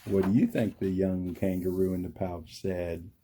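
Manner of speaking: put-on soft teasing question to kids; reading to children. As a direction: ask the kids gently.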